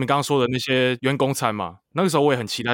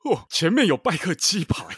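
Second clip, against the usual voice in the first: higher, put-on celebrity voice; 5 semitones higher than usual. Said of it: "你没有用[name]跟他讲".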